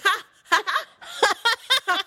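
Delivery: loud, high mocking "ha, ha, ha"; mock laugh. Girlfriend catching boyfriend out, loud mocking laugh. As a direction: laugh right in his face. Acting her scornful laugh at him.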